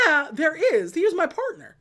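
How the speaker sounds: high-pitched, acted-out protest; mock exasperated comeback; heated insistence. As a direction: argue back, insisting you are right. Retold heated insistence back at her.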